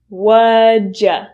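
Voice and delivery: What'd ya said as whaja; reduced form. Shown as a demonstration. Teacher modelling the reduction whaja. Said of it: "will reduce to".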